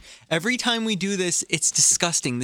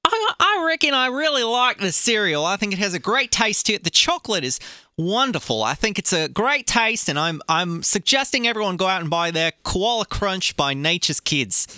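put-on higher, hammy Australian accent; 4 semitones higher than usual. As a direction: sell it like an Australian ad. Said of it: "Do you like my Australian accent?".

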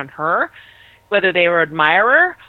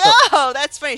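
amused reaction; 6 semitones higher, 5 dB louder than usual. Acted. Acting out someone laughing at her.

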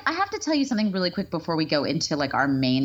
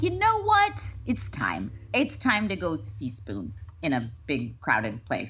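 mock decisive self-talk; 4 semitones lower, slower than usual. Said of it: "I'm like".

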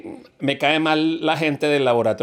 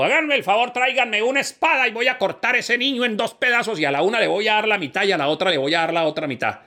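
Solomon's command; 9 semitones higher than usual. Acted. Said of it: "llegó y dijo".

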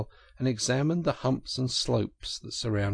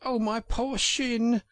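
high, drawn-out wail of pain; high-pitched, slow "ow"-like lament; pained cry; 13 semitones higher, slower than usual. Acted. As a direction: cry out slowly and high over a sore shin. Hurt Mole cries out.

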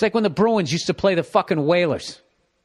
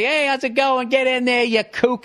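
high-pitched friendly greeting; breezy mock Australian official; jovial customs officer; 7 semitones higher than usual. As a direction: wave them through, pitched well up.

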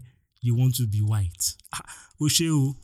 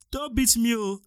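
mock plea; 10 semitones higher than usual. Pleading with imagined attacker.

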